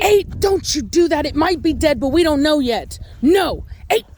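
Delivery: high, insistent telling-off; scolding a dog. Real, not acted.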